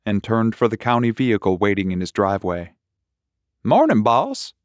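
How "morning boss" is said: deputy greeting the sheriff through the truck window, chirpy. Acted. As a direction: chirp it cheerfully. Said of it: "Deputy Porter chirped".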